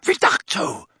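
voiceless "Reducto"; breathy hushed spell. A narrator's reading. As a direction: rush "Reducto" out unvoiced. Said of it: "a voice shouted".

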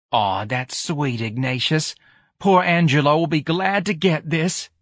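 a New Orleans mama touched by the gift; doting mother rising with delight; fond mother. A narrator's reading. Mother thanks her son.

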